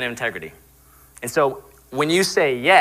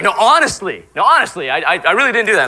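"honestly" punched high and loud, mock pleading; hammed-up insistence, leaning on "honestly"; emphatic insistence; 6 semitones higher, 6 dB louder than usual. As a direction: protest loudly, hitting "honestly" hard each time. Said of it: "if we emphasize that word".

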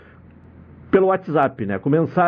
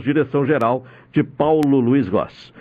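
ordinary talk, nothing marked

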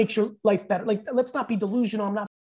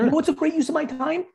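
higher pitched rising question; emphatic question; 8 semitones higher than usual. Real, not acted. To the host, emphatic rising rhetorical question. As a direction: lift it up to set the punchline.

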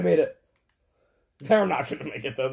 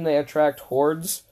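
ordinary talk

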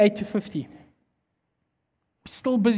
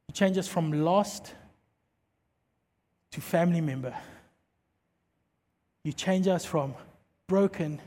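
closing prayer; 4 semitones lower than usual. Real, not acted. Pastor praying for the congregation, hushed and earnest. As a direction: pray it gently over the room.

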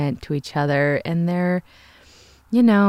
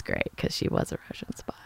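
whisper; 12 dB quieter than usual. Real, not acted. Conspiratorial aside about the spy.

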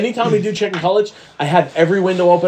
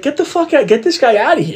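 higher, dismissive girl's voice, lightly put on; imitated girl; 6 semitones higher than usual. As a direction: brush him off like an unimpressed woman. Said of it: "If you're a chick, they're gonna be like".

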